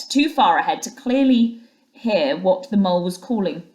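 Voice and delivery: ordinary delivery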